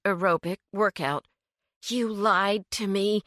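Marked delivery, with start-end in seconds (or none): 1.9-3.2 slow, hissed accusation; seething hiss. A narrator's reading. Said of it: "Kinsley hissed".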